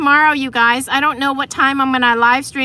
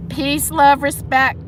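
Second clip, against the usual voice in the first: ordinary talk, nothing marked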